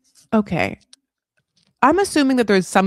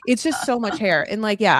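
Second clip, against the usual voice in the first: ordinary delivery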